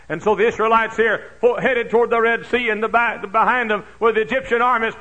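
high, loud fervent preaching; preaching at full force. Acted. Pastor preaching to his congregation, full force. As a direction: drive the sermon home to the crowd.

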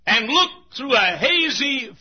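ordinary talk

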